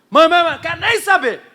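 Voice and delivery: high, loud Mãe, mãe, mãe cutting her off; impatient refusal. Retold or imitated. Son cutting off his mother's gossip, impatient. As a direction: brush off the gossip. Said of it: "eu falo assim".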